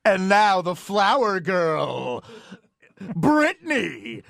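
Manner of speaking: slow announcer voice, a hint of a wedding DJ.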